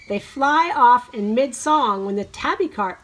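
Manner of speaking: ordinary talk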